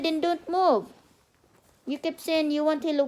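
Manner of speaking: normal talking voice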